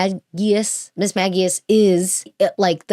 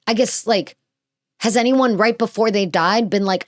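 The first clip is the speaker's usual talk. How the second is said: ordinary talk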